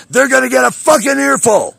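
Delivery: real angry yell; furious shout. Real, not acted. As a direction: explode on the word earful. Furious rant about city officials.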